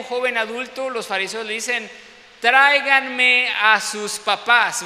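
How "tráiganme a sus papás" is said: loud, slow and commanding; stern demand. Retold or imitated. Pharisees demanding the blind man's parents, loud and slow. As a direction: bark the order at the blind man.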